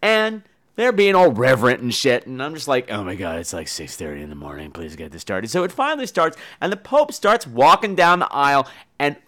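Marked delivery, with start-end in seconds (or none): 2.9-5.5 low, very soft grumble to oneself; mumbled plea under the breath, pitched way down; hushed impatient aside. Retold or imitated. Bored among reverent nuns at early mass, hushed impatient aside.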